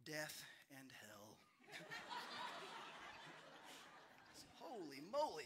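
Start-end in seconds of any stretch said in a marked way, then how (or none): 0.1-1.4 slow, low, gloomy little answer; a crushed kid mumbling his fate; slow and deflated, lower; dejected child. Retold or imitated. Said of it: "looks dejected and looks down and says". 1.6-4.5 high breathless laugh; airy giggle; real laugh. Real, not acted. Speaker laughs at the story.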